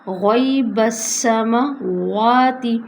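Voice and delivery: slow Tajweed reading, heavy ghain; teacher modelling the recitation; Quranic recitation. Shown as a demonstration. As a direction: recite غیب السماواتی slowly with a thick ghain. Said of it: "غین موٹے حروف میں سے آ گیا".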